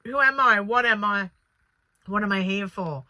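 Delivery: mock desperate who-am-I; lost amnesiac. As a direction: plead to know who you are, then settle. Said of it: "having amnesia and going".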